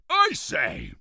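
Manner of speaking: a grand king greeting; pompous king. Acted. King Toothbrush Weasel voiced.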